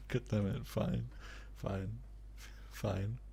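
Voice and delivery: normal talking voice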